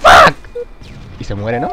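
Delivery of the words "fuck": loud, high alarmed yells; startled shouts. Real, not acted. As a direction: shout as the enemy jumps you. Enemigo aparece en el juego. (gritos de susto, agudos y fuertes)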